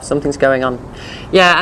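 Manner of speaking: ordinary delivery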